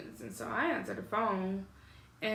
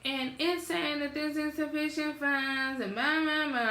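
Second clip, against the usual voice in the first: mock grandma voice, dismissive and high; annoyed grandmother imitation; 6 semitones higher than usual. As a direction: parody grandma, letting it trail into blah-blah. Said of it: "so this is how she sounds".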